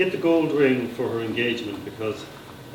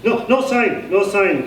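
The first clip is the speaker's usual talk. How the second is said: high, loud eager call; a simple young man reports, excited and pitched high; excited stammering lookout; 8 semitones higher, 5 dB louder, slower than usual. Acted. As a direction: call it out like a keen sentry. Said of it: "came the sentry's reply".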